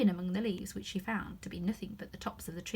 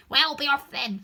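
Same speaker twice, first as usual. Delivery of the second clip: sulky; 5 semitones higher, 9 dB louder than usual. Acted. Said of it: "said the pigeon in a sulky tone".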